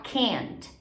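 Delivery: American can't with a front "cant" vowel; American accent. Shown as a demonstration. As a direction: say can't American, muscles pulled tight. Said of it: "sotaque mais americano".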